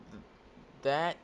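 usual speaking voice

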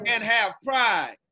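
slow, loud chant; call-out shout. Shown as a demonstration. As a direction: shout it for kids to echo. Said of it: "continue to repeat after me".

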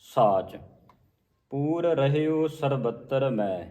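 slow, measured verse; scripture recitation. Acted. Teacher recites the verse slowly. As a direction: recite the line slowly and carefully.

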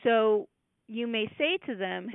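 ordinary talk

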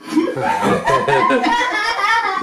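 a sudden loud burst of high laughter. Real, not acted. Dad cracks up at the meow, loud laughing.

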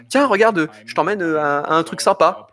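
high-pitched, playful talking-dog voice; voiced friendly dog. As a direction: speak for a dog eager to show something. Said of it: "puis ils vont faire".

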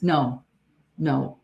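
ordinary talk, nothing marked

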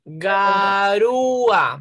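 Garúa drawn out slowly, stress on the ú; slow example word. Shown as a demonstration. Teacher to pupils, slow model word Garúa. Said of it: "Miren acá".

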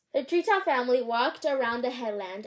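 usual speaking voice

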